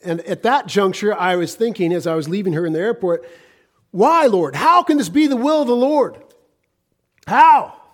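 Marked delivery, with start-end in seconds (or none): 3.9-7.8 high, loud plea to heaven; anguished outcry. Retold or imitated. Husband leaving his wife at the airport questions the Lord, anguished outcry. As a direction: cry out in protest, re-enacting your own despair. Acted cry to God at airport.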